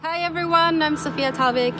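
ordinary talk

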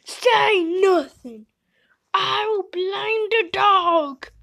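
loud, high and slow, crying threat; menacing cat voice. Acted. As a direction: snarl it out as a furious cat.

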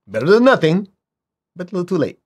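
ordinary talk

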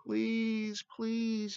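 high, drawn-out plea; pleading call. A narrator's reading. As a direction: call out pleadingly, high but not shouted. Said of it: "he called out as he was near enough".

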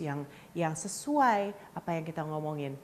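ordinary delivery

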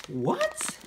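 high-pitched, rising "what?"; incredulous. Acted. Shopper amazed by store-brand sweet potatoes, incredulous. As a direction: squeal it in playful disbelief.

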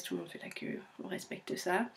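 whispered aside. Real, not acted. Vlogger whispering a joke about British queueing to viewers. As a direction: drop to a whisper as if sharing a secret.

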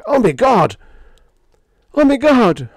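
very high, loud horrified scream. A narrator's reading. Sparks watches his radio set being smashed, screaming. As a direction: scream it out in shock, high-pitched. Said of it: "and I screamed".

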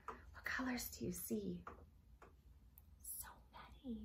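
soft secret whisper to the little listeners. A narrator's reading. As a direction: lean in and whisper the question. Asking children about the colors.

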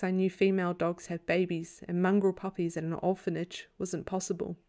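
ordinary delivery, nothing marked

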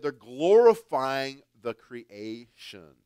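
loud and slow, pressing on glorifying; emphatic preaching. Acted. Preacher warning the church, loud and slow. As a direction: drive the point home with force. Preacher repeats the key phrase.

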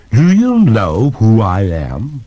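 slow portentous ghost. Acted. Ghost's grand reveal question.

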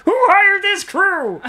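high, loud, slow frog puppet; Kermit the Frog voice. Pretend. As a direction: whine it like Kermit. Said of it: "Doing your best Kermit the Frog impression".

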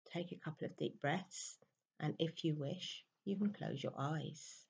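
very soft, unhurried meditation voice with long pauses; guided meditation. Real, not acted. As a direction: gently guide listeners into stillness, hushed and even.

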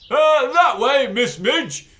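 loud, high, worried headmaster; concerned. Acted. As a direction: warn her kindly but anxiously. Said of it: "in a concerned voice".

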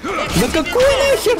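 high, slow squeak of disbelief; squeaky outrage. Retold or imitated.

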